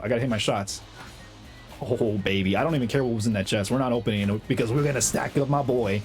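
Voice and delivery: ordinary delivery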